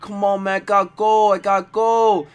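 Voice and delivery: high whiny begging. Retold or imitated. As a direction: plead like a desperate bum. Bum begging for bathroom.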